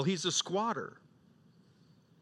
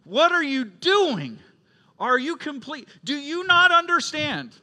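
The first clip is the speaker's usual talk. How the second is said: loud, high reenacted yell; retold angry yell; 10 semitones higher, 7 dB louder than usual. Father yelling at his youngest son over the towel, loud and angry. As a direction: shout it like the exasperated father again in the moment. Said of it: "And I yelled".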